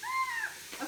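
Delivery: sudden high sharp shriek as oil spits; real jolt of surprise, a piercing cry; startled shriek. Real, not acted. Oil spatters again.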